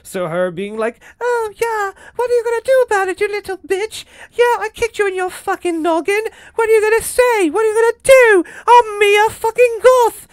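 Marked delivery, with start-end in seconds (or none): 1.2-10.3 high squeaky taunting mouse voice, louder at the end; mock a tiny British mouse sneering threats; Mia Goth impression. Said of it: "she sounds like a fucking British mouse".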